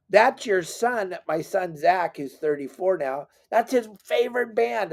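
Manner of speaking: a hint of exasperated wife; insistent. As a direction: make him get it. Wife explaining excitedly.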